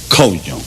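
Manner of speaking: "coño" said low and soft, with the ñ stressed like ny; pronunciation demo. Shown as a demonstration. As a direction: drop low and sound out "coño" carefully. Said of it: "And it's pronounced".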